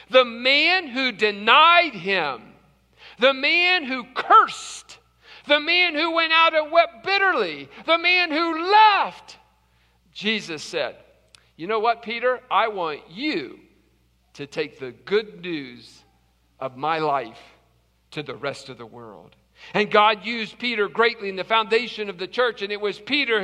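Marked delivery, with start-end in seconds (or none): 0.1-9.4 louder, higher and slow, each phrase climbing; building preacher emphasis. Real, not acted. Preacher to congregation about Peter's failures, rising emphatic build. 11.6-19.3 tender commission. Acted. Jesus recommissions Peter gently.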